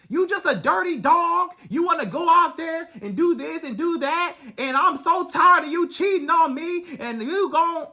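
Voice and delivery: high, shouted name-calling; hammed-up angry woman; mock yelling girlfriend. Woman calling her cheating man names, high mock shout. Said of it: "you calling him all these names".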